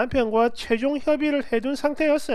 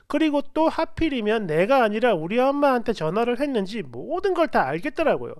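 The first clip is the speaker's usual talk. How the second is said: plain reading voice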